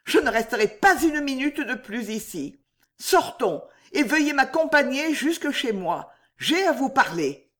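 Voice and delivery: voix de femme haute, bouleversée; voix altérée. A narrator's reading. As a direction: presse le départ, le ton monté. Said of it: "dit-elle d'une voix altérée". (urgence troublée, dans l'aigu)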